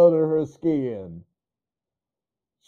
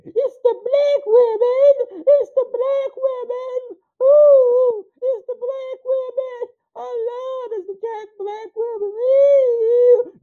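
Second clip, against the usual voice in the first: much higher, slow crying whine; whiny mimicry; slower than usual. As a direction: mimic her wailing like a victim. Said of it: "here we go again".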